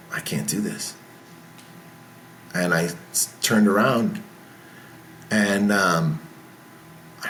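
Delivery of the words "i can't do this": soft, low, defeated; quiet despair. Retold or imitated. Backstage before his talk, a soft hopeless murmur. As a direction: say it under your breath, giving up.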